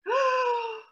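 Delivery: long, high intake of breath; horrified gasp. A narrator's reading. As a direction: gasp in sudden dismay. Mum notices she is in nightie.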